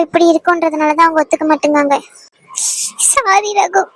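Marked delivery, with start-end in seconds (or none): 3.1-3.8 tearful, high, breaking voice; a tearful sorry to her absent fiancé; crying apology. Acted. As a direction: sob out the apology alone. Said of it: "உட்கார்ந்து அழுதுகிட்டு இருப்ப".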